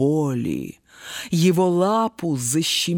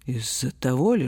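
plain reading voice

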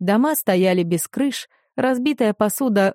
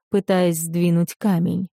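usual speaking voice

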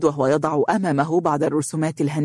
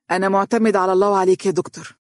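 ordinary delivery

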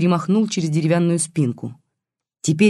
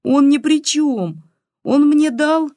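put-on very high, slow girlish protest; plaintive whine; 11 semitones higher, 5 dB louder, slower than usual. A narrator's reading. Granddaughter defending the detective to granny, plaintive whine. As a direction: wail it out, stretching the words. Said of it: "жалобно заныла Катька".

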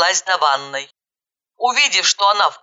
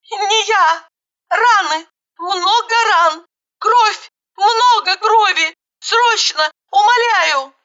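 very high, panicked bursts; frantic yelling; 13 semitones higher, 3 dB louder, slower than usual. A narrator's reading. Caller describing a bleeding girl to dispatcher, frantic. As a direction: cry out each word in high-pitched alarm. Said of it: "завопила, что было мочи".

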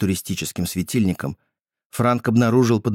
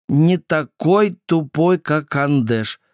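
high, slow, odd brother voice; lightly voiced strange child; Andesh's voice; 6 semitones higher, 3 dB louder, slower than usual. A narrator's reading. Andesh finishing sentence.

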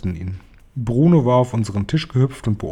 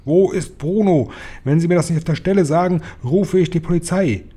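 high-pitched, agitated demand; angry yelling; 7 semitones higher than usual. A narrator's reading. Father demanding his son's whereabouts, angry yelling. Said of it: "schrie Mister Jenkins".

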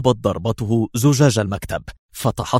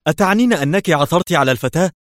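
much higher, louder, hopeful; eager cry; 8 semitones higher, 4 dB louder, faster than usual. A narrator's reading. The ambassador hears the girl was found, eager. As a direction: burst out with it. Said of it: "هتف في لهفة".

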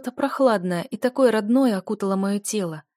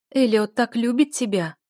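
earnest persuasion; 5 semitones higher, 5 dB louder than usual. A narrator's reading. Lady pleads for her son.

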